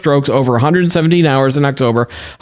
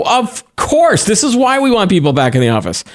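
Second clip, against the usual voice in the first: smug boss; 7 semitones higher than usual. Retold or imitated. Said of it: "you go".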